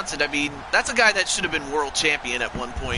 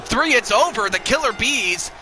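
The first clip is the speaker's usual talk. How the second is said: wrestling commentator; 3 semitones higher than usual. Acted. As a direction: call the pinfall with rising energy. Calling the pin and finish.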